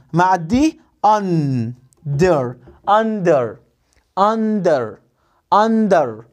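"under" with short u, clear n-d; sound out under. Shown as a demonstration. Teacher modelling "under" for pupils, carefully. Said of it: "فيها شورت يو وفيها شورت اي".

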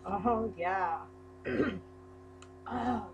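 usual speaking voice

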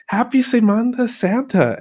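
put-on high, loud gringo-accented Spanish; put-on gringo foreigner accent, pitched high. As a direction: ham up a cheery American tourist's gringo accent. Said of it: "we would say it with our gringo foreigner accents".

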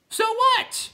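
very high, loud shriek; called down. A narrator's reading. Clown calling down to Bastian, shrill high yell. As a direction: yell it down from the tower top. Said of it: "the first called down".

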